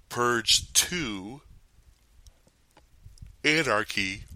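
words stretched by a yawn; talking through a big yawn. Real, not acted. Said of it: "I'm so sorry that I keep yawning".

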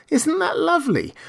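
put-on very high little-boy wonder; delighted child. A narrator's reading. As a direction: marvel at it as the young king, high and light. Child king delighted by painted butterfly.

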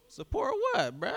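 high, loud baffled reply. Retold or imitated. Answers his CEO, high baffled voice. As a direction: react in stunned disbelief.

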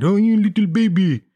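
put-on high cooing baby talk; talking to cat. Mocking himself talking to his cat, high cooing baby talk. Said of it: "talking to my cat like that".

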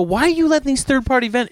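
high, exasperated complaint; getting annoyed. Retold or imitated. Complaining to the clerk about resellers, annoyed and high. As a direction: push back at the clerk, rising irritation. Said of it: "then I'm getting annoyed".

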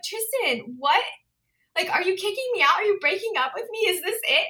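high, frantic retelling of her panic; panicked self-quote. Retold or imitated. Remembering the check from Tristan, high panicky retelling. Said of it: "I'm like".

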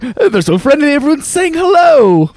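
high, slow, cheerfully clueless Daddy Pig. Retold or imitated. Daddy Pig waving at honking drivers, cheerfully clueless. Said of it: "and he's like".

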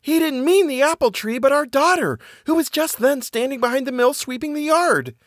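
very high, horrified wife's voice; terrified wife breaking awful news. A narrator's reading. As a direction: pitch it high with sustained alarm. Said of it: "said the woman, terrified".

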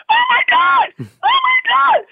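very high falsetto screaming; hammed-up, high-pitched scream of joy; imitated ecstatic shriek. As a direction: squeal it like an overjoyed wife hearing huge news. Wife reacts to his big news.

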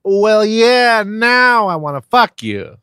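high, loud leering come-on; leering guy voice. Retold or imitated. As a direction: ham up a horny guy. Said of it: "then he'd be like".

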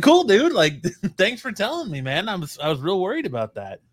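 high-pitched laughing sarcasm; mock gratitude, cracking up as you retell it; sarcastic, laughing.

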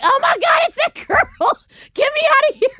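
high, shrill mock fan cry; mock panicked fan. Pretending a fan dreads seeing her, high mock panic. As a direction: play a fan fleeing in fake panic, pitched high. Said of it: "anyone sees me and cry like".

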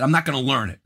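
usual speaking voice

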